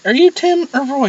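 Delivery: put-on high, light girlish voice; high girly question. Kimmy asking the drunk guy his name, high girly voice. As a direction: ask it like a puzzled party girl. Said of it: "Kimmy was like".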